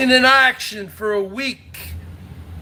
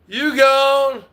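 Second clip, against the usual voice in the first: ordinary delivery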